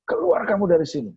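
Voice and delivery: high-pitched mock yell, no real volume; retold shout. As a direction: imitate crying out a rebuke in prayer. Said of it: "Saya bilang, saya teriak-teriak".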